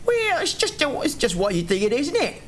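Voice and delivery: high-pitched gnomish wizard, failed Cockney; botched Cockney wizard. Pretend. Showing friends the wizard voice, high mock Cockney. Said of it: "this kind of voice that goes like".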